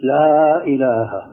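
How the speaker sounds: لا إله with the a of لا held two beats, slowly; two-beat stretch on la. Shown as a demonstration. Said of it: "فبدك تمد حركتين". (لا إله بمد حركتين)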